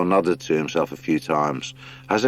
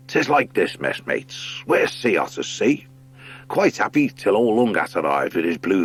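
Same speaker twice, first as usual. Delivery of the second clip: rough sailor otter telling a tale of woe; salty seafaring storyteller voice; sea otter skipper; 3 semitones higher than usual. Acted. Otter skipper tells the hares his woes, gruff sailor voice.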